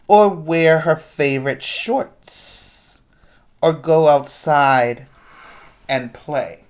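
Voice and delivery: put-on lively read-aloud to kids; picture-book reading voice; story narration. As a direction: read it like storytime, big and expressive.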